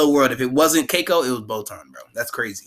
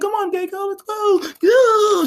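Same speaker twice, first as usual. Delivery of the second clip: high wailing cry, building louder; mock sobbing; 4 dB louder, slower than usual. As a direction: imitate someone hauling away a sobbing mourner, wailing. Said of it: "crying, sobbing, real sobbing".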